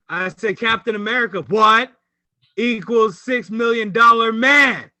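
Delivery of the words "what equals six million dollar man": louder, slow punchline shout; loud slow excited reveal. Real, not acted. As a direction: announce it like a big reveal.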